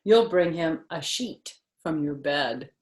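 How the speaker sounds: plain reading voice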